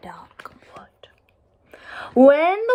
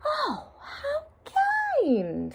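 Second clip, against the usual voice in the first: much higher, very slow fake-sweet coo; mock sweet wolf; 5 dB quieter, much slower than usual. A narrator's reading. Wolf flattering the girl, high and fake-sweet. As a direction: gush falsely like a sly wolf. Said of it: "said the voice".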